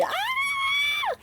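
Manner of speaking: long, very high thin squeal; scream. A narrator's reading. Annie is pushed along with Percy, scream. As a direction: squeal it softly but piercingly high. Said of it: "screamed Annie".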